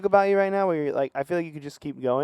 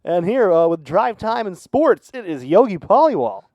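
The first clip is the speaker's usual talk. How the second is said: announcer voice; 4 semitones higher, 6 dB louder, slower than usual. Pretend. Announcer intro of guest.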